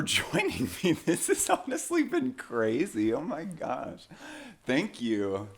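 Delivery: flirty giddy phone goodbye; giggly late night call. Pretend.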